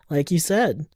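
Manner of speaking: ordinary talk, nothing marked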